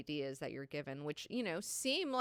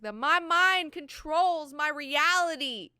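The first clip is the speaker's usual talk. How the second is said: stoked; 10 semitones higher, 11 dB louder than usual. Retold or imitated. Young man announcing his discovery to Jessica, high and loud. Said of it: "He's so stoked".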